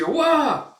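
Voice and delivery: loud, high "wah" of surprise; sudden high-pitched cry; startled yelp. Acted. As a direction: yelp as if jolted.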